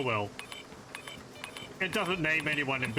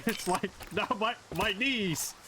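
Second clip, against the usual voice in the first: pained whine; 6 semitones higher, slower than usual. Real, not acted. Pained reaction after a fall.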